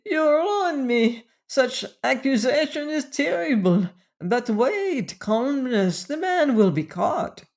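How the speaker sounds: high, pleading French manager; bleating. A narrator's reading. French manager begging accusing magnate for calm, bleating. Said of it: "he bleated".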